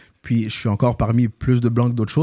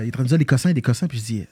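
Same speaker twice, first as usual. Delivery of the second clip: ordinary delivery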